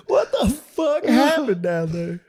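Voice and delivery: slow, very high tearful wail; hammed-up weeping disbelief; mock-distraught father. Father asks daughter what happened, wailing. Acting upset father on phone.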